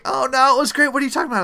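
high, light mock protest; mock wife protest. Retelling his wife brushing off his complaint, high mock protest. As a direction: pitch up into the wife's protest.

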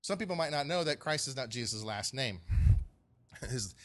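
ordinary delivery

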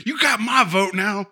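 high-pitched mock Southern cheer; Southern townsfolk. Townsfolk clamoring back to the mayor, mock Southern. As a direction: put on a hammy Southern supporter's voice. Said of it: "sound like they're somewhat Southern".

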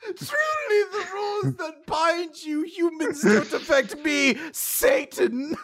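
very high, hammy devil; lofty disdain for mere humans; Satan's mock boast. Host voicing Satan addressing humans, grandiose villain.

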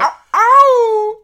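loud high catcall; ow ow catcall, playful. Pretend. Cheering shirtless Henry.

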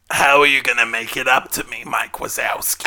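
husky put-on lady voice; Harvey Fierstein voice. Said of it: "I don't know, Harvey Fierstein".

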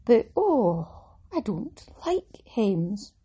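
slow, drawn-out grumble; venting. Real, not acted. Podcaster to listeners about sewing a dress, slowly venting. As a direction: drag out each word of the complaint. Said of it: "I'm gonna vent about hems".

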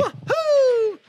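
very high, loud, slow drawn-out "woohoo"; Mario woohoo. Retold or imitated. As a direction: stretch the Mario cheer way up high. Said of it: "That's Mario".

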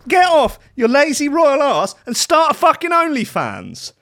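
normal talking voice

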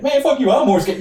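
high, loud heated protest; mock argument. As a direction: shout it back, pitch shooting up.

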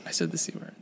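whispered confession; whispering. Real, not acted. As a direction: whisper it under your breath. Confessing the C word.

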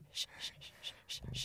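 very soft, rhythmic shushing; imitated soothing shush. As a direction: shush a baby back to sleep. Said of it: "trying to settle him".

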